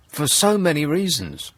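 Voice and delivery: ordinary delivery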